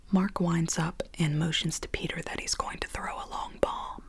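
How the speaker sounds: ordinary talk, nothing marked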